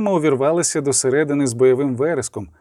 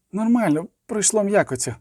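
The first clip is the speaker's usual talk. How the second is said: high-pitched, a touch unsteady; slightly trembling call; 5 semitones higher than usual. A narrator's reading. Wounded girl reassures the witcher, slightly trembling. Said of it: "крикнула дівчина у відповідь лише трохи тремтячим голосом".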